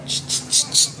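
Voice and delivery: unvoiced hissing ch sounds; hissed ch noises. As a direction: hiss short ch bursts. Imitated noise.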